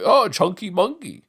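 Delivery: high, slow sing-song taunt; mocking taunt. Troll teasing someone for being fat, high and mocking. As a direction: drag it out high like teasing a kid. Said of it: "You know".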